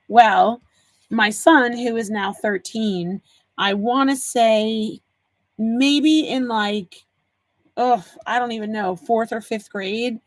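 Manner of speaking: normal talking voice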